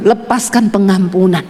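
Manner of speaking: lightly voiced God, low and slow; God's voice, retold. God answering what to pray for, lightly voiced low command. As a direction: retell a divine order, low and measured. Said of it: "tiba-tiba dengar suara Tuhan tuh ngomong gini".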